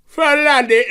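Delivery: put-on high, loud London accent; hammed-up London accent. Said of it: "Do a London voice for me".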